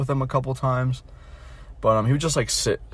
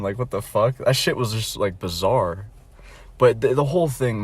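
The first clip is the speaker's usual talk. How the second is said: ordinary talk, nothing marked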